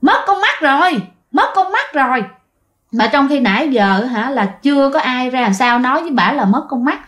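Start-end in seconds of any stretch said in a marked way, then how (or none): 0.0-2.2 higher, mildly gleeful possessed voice; a touch of laughing through the words; laughing while speaking. Acted. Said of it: "bà Sáu vừa cười vừa nói". (giọng bà Sáu bị nhập vừa cười vừa nói, cao hơn)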